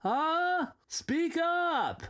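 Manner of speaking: high, strained call to speak up; yelling at the screen. Real, not acted. Recording too quiet to hear.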